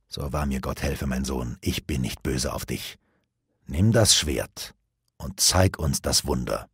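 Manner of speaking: low, soft, solemn assurance; hushed fatherly sincerity; reassuring father. A narrator's reading. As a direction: speak gently and low to calm a son. Father reassures his anxious son.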